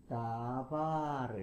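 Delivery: '다바르'의 '다'를 느리게 길게 끌기; '다바르'를 '다-바-르'로 늘여 발음. Shown as a demonstration. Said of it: "요렇게 간다라는 거". ('다바르' 장모음으로 천천히)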